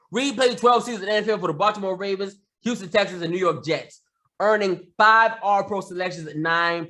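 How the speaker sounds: steady read of a news story. Acted. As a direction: deliver it like an anchor. Reads a sports news story.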